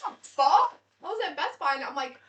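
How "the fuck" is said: loud, high-pitched mock outrage; acted disbelief. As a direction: burst out as if insulted. Acted reaction to looking nineteen.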